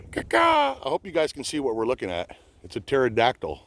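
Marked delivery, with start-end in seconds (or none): 0.1-0.8 high cawing like a crow; crow caw imitation. Talking to a bird overhead, mock crow caws. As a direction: caw back at the bird in its language. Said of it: "Don't worry, I speak the language".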